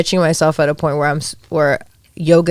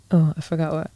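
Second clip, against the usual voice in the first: ordinary delivery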